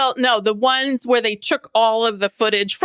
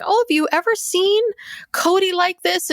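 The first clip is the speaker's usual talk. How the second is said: much higher, lightly acted worried questions; concerned friend impression; 9 semitones higher than usual. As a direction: imitate her fussing in a high voice. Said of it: "she's like".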